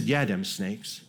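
quiet, slow "Yeah, them snakes" said low; low slow reply. Retold or imitated. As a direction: drop low and slow like a deadpan instructor. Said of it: "He said".

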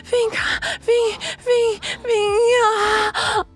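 high, urgent moaned urging; crying out in pleasure. Acted. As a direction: urge breathlessly in short bursts. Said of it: "E eu gritando de tesão".

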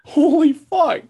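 very high, loud squeal of disbelief; high-pitched stunned exclamation; real shock. Real, not acted. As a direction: squeal it in astonishment. Seeing the amount of meth.

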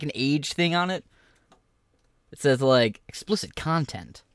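ordinary talk

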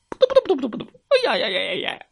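high flapping sound. Acted. Bird flees in fright.

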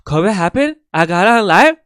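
high, loud, hyped-up streamer voice building up; Pinky Doll impression. Hosts quoting Pinky Doll's origin story, high and hyped. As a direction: belt it out like a peppy NPC streamer. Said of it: "actually, she said".

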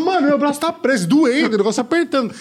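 high, panicked plea. Retold or imitated. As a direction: squeal about the stuck arm. Retold pain with stuck arm.

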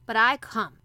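loud, stubborn insistence; grumpy obstinacy. A narrator's reading. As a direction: dig in your heels, grumbling. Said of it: "with grumpy obstinacy".

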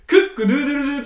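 rooster crow imitation. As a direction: crow クックドゥドゥドゥ loud like a rooster, laughing. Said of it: "クックドゥドゥドゥ的な？". (ニワトリの鳴き真似で大きく)

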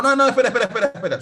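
high, loud burst of objection; retold annoyance. Korean YouTuber stopping a video he reacts to, annoyed. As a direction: cut in sharply, imitating an annoyed YouTuber. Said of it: "se amargó".

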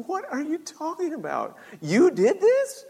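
high disbelief, swelling on the last question; incredulous dad. Retold or imitated. Dad learns son did the damage, high incredulous questioning.

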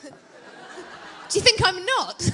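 laughing it out, high and loud. Real, not acted. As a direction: laugh through the mock protest. Laughing at being called old.

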